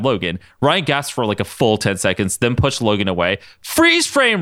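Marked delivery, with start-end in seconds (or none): 3.6-4.4 high mock scream. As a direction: yell it up high. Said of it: "Ryan screamed".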